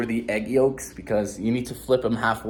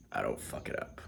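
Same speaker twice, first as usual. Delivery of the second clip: low, unvoiced morning voice; 14 dB quieter than usual. Real, not acted. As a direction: whisper it in a low just-woke-up voice. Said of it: "I've got morning voice".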